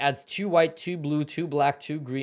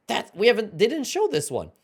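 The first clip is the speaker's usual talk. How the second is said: excited; 10 semitones higher, 5 dB louder than usual. Real, not acted. Spotting an unseen card.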